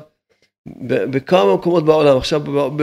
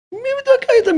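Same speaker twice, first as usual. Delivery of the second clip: high mocking voice; faster than usual. Rabbi scoffing at those arguing over two hundred shekels, high and mocking. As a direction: jump way up into a high, incredulous mocking pitch.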